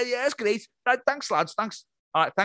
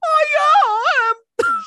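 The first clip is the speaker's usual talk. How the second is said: extremely high strained squeak, louder; 6 dB louder, much slower than usual. Acted. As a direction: shoot into a ridiculous falsetto.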